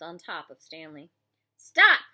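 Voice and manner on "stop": sudden loud, high shout; explosive one-word command. A narrator's reading. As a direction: shout it to halt a fight. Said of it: "shouted Mister Pendanski".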